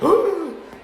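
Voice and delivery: a big high startled gasp; sharp gasp. Retold or imitated. Customer hears the price and gasps sharply. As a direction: gasp like the price just hit you.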